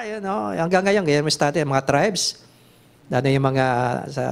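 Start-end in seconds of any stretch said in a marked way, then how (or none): none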